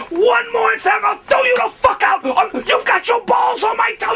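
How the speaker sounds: very high-pitched, frantic shouting; going crazy. Retold or imitated. Host raging at naked drunk guest, going crazy. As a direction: rant at full tilt, high and stretched on the curses. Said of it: "and he's going crazy".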